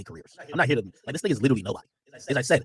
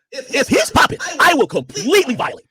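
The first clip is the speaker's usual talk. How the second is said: high, loud bragging; high, loud and slow boast; hyped boast; 13 semitones higher, 10 dB louder, slower than usual. Real, not acted. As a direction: shout the threat, hyped. Boasting loudly.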